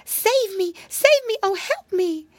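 high-pitched, urgent cries; frantic, high lady cat pleas; calling for help. A narrator's reading. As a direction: cry out high for rescue. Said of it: "he heard a voice calling".

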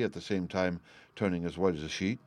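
ordinary delivery, nothing marked

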